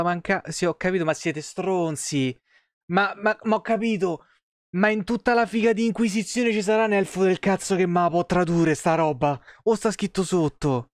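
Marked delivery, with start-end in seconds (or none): none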